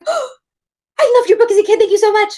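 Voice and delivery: very high, loud gushing fan; overexcited gushing. Retold or imitated. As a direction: blurt it out to your hero. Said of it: "I got really excited".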